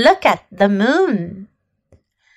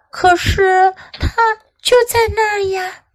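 put-on high, small child voice; timid little voice; 7 semitones higher than usual. A narrator's reading. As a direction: protest timidly like a little girl. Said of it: "阿曼达小声地说".

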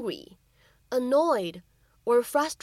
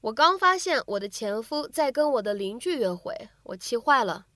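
ordinary talk, nothing marked

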